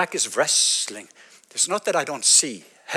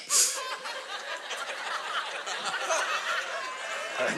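breathy laugh; 14 semitones higher, 10 dB quieter than usual. Real, not acted. Laughs at own joke.

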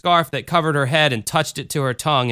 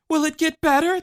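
put-on sobbing child. A narrator's reading. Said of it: "the child sobbed".

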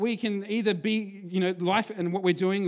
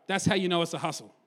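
ordinary talk, nothing marked

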